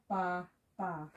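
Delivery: ba, ba said softly and carefully; model reading. Shown as a demonstration. Teacher demonstrating how the vowel signs read. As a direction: read out ba, ba as an example. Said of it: "all these would read as ba".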